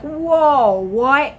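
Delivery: high, loud, startled reaction; retold alarm. Woman seeing friend pull out a needle, startled.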